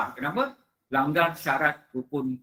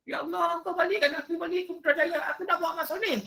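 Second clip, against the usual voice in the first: high, fast desperate plea; pleading dead soul; 14 semitones higher, faster than usual. Retold or imitated. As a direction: plead high and hurried to be sent back. Dead soul begs to return.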